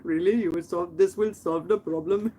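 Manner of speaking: lightly imitated Indian accent, questioning; upset Indian neighbor. Upset neighbor asks if spraying works, light mock Indian accent. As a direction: ask doubtfully with a hint of Indian accent. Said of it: "He was so upset".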